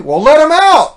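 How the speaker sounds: high, loud joke-teller's yell; shouted punchline. Acted. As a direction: holler the gag's payoff.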